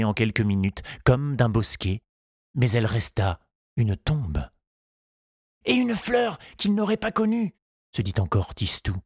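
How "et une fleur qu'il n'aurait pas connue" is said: put-on high, light boy's voice; child voice. A narrator's reading. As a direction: voice a hopeful little boy. Tistou's thoughts voiced.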